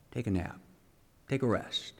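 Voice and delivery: soft, drowsy mock advice to the prophets; mock quiet taunt. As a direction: hush it down like a mocking lullaby. Elijah mocks the waiting prophets.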